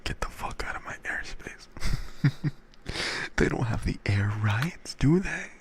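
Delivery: steady whisper; playful whisper. Real, not acted. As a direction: whisper the joke conspiratorially. Whispered stream chatter.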